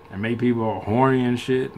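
ordinary delivery

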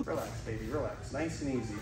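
soft soothing voice; calm the snake down gently. Real, not acted. Soothing the cobra softly.

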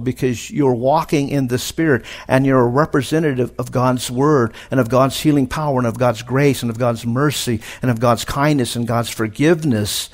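ordinary talk

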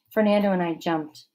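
plain reading voice

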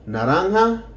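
normal talking voice

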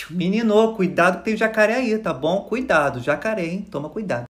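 imitated unhurried, low-key warning; very calm woman. Calm stranger warning a swimmer of an alligator, very calm. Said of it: "uma mulher calmíssima pra mim e falou assim".

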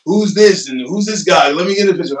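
lightly voiced curious passerby; crackheads on the street. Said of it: "crackheads saying".

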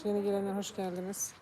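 ordinary talk, nothing marked

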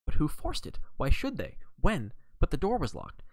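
mild excited outburst. Acted. Excited exclamations from listeners.

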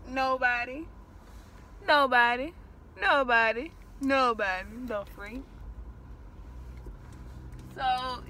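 ordinary talk

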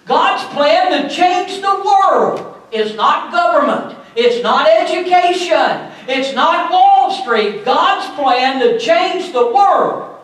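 ringing pulpit delivery; full-force preaching. Real, not acted. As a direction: preach it out, rising.

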